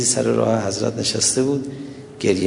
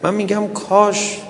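higher-pitched, plaintive preacher's lament; mourning recital; 6 semitones higher than usual. Acted. Preacher mourns Fatima before the congregation, plaintive lament. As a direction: plead in grief, stretching the phrases.

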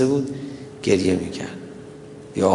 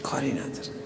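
hushed echo; 11 dB quieter than usual. Real, not acted. Preacher repeats it to the mourners, hushed whisper. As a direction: let it fall to a whisper.

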